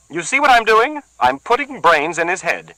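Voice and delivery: high, loud gloating woman's voice; shrill taunting woman. Acted. Bertha gloats to the bleeding man, high and loud. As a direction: crow over the beaten man.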